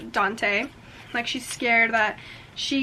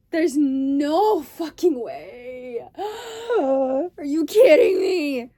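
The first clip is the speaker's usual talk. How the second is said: slow, higher, teary disbelief; stunned disbelief; 3 semitones higher, 4 dB louder, much slower than usual. Real, not acted. Reader can't believe the twist, stunned and teary. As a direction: drag the words out in shock.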